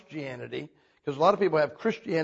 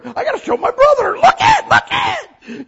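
very high, loud shouting; excited shout; 7 dB louder than usual. Retold or imitated. Steve shouting to his brother, high and loud. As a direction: shout it out breathless with joy.

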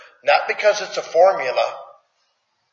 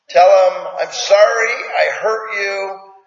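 exasperated parent coaching; 5 semitones higher, 5 dB louder than usual. Retold or imitated. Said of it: "No. Okay".

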